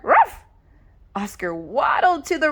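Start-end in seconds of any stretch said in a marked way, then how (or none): 0.0-0.4 high, loud single woof; loud bark. Acted. Oscar barking to the rescue, loud bark. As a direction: bark it out bravely. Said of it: "with a loud bark".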